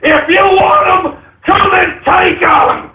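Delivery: shouted dare at the gun grabbers; defiant shout. Acted. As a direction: dare them to come and get it. Defiant rant peak.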